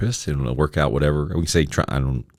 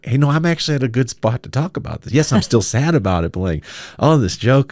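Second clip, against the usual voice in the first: higher, fast, cheerful; upbeat reassurance; 9 semitones higher than usual. Retold or imitated. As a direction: reassure the crowd brightly. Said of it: "I'm like".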